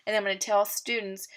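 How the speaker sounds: ordinary delivery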